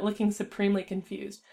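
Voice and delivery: ordinary delivery, nothing marked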